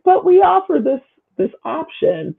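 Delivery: high-pitched imitation of officials objecting; mock protest. Voicing a governing body defending itself, mock protest. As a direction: start very high, then drop towards normal.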